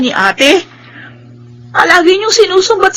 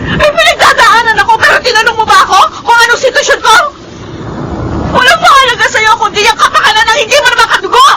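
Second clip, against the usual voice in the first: crying furious outburst; 5 semitones higher than usual. Acted. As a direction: scream your hurt at her, crying. Younger sister's tearful outburst. (umiiyak na galit na sigaw)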